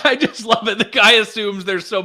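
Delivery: delighted laugh; laughing with glee. Real, not acted. Laughs at clip.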